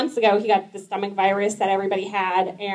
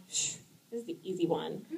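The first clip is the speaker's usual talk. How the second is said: a conspiratorial hush, as if sharing a secret; soft confiding hush; hushed aside; 5 semitones lower, 16 dB quieter than usual. Pretend. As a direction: drop to a near whisper.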